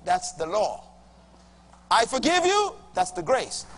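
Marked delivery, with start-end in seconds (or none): 1.9-2.8 higher, louder proclamation of forgiveness; proclaiming God's words. Retold or imitated. Preacher repeating God's words to the woman, proclaimed high and loud. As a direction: lift the words high and ring them out. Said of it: "God says".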